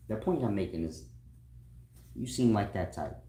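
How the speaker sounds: ordinary talk, nothing marked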